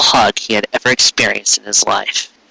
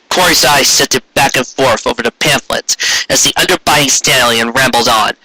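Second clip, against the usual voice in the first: ordinary delivery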